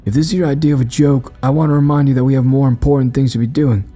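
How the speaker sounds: indignant anger. Acted. Quincy finally standing up to the madman, mildly angry. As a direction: pitch it up, found some resolve. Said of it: "found the resolve to feel angry".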